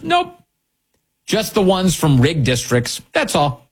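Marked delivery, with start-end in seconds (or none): 0.0-0.3 high curt nope; flat no. Real, not acted. Host dismissing the Democrats' claim, curt high nope. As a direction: snap it shut, high.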